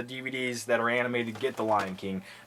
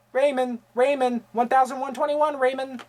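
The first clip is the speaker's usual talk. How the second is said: higher, flat repeated Raymond; Rain Man quote; 8 semitones higher than usual. Retold or imitated. Vlogger quoting Rain Man in a higher put-on voice. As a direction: repeat the name like Dustin Hoffman.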